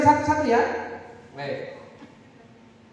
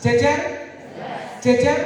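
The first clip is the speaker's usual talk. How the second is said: loud, short, emphatic call; firm intonation; 2 semitones higher, 3 dB louder than usual. Shown as a demonstration. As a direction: drill it firmly into the class. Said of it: "kalau saya ngomong tegas". (intonasi tegas dan lantang)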